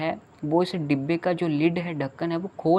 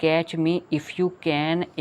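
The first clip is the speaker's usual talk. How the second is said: slow title; much slower than usual. Shown as a demonstration. Announcing the poem title.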